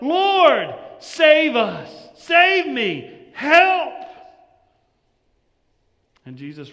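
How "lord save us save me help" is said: loud, high desperate cry for help. Retold or imitated. Peter sinking in the water, a loud desperate cry. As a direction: yell it like a man sinking in the waves. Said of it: "He cries out".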